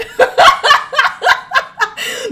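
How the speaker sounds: long high cackle; real high, loud belly laugh. Real, not acted. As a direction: crack up at your own admission. Laughs at her own admission.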